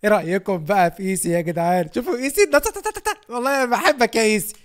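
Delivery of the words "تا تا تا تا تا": high playful ta-ta-ta. Real, not acted. Playful fanfare for Essi.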